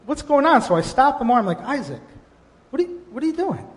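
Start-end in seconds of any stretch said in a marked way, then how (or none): 0.1-0.6 mildly exasperated, pitch up; a touch of annoyed father; frustrated. Retold or imitated. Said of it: "I got a little bit frustrated". 1.6-3.7 higher, puzzled and mildly cross; a hint of a scolding dad; frustrated father. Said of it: "I got a little bit frustrated".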